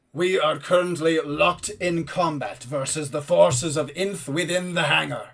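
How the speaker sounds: formal, forceful commander; Holthrein. Acted. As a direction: report with measured military authority. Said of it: "a powerful voice".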